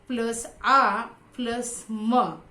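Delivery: क, आ, म spelled out slowly and firmly; slow sound by sound. Shown as a demonstration. Teacher spelling sounds for students, slow and deliberate. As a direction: break काम into sounds, one at a time. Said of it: "अलग-अलग करने से".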